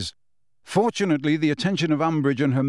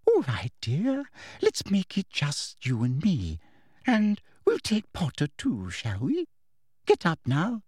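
motherly voice; 4 semitones higher than usual. A narrator's reading. Umbridge coaxing Hermione, falsely motherly. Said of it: "thought was a motherly voice".

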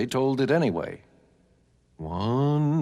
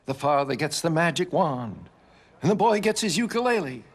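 ordinary delivery

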